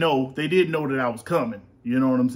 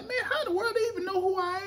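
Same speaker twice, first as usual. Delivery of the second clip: high mocking self voice; 4 dB quieter, faster than usual. Own retold quote, falsetto.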